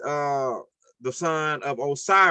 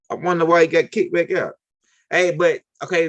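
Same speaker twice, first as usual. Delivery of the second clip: usual speaking voice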